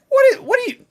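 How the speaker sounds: high, loud disbelieving outburst; can't believe what chat just said; incredulous. Real, not acted. Streamer reacting to a viewer's take, stunned and incredulous. Streamer baffled by chat's opinion.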